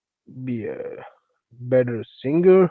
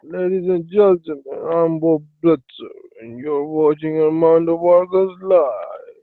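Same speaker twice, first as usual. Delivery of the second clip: show announcer intro; 3 semitones higher than usual. Acted. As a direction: announce the live show to an audience.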